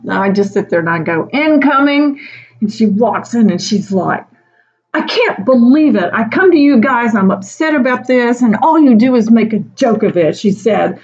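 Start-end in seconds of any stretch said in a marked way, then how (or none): 1.3-2.2 hammed-up alarm call; mock warning. Warning friends she's coming. 4.9-10.3 higher, louder mock hurt; hammed-up indignant scolding; mock wounded anger; upset colleague.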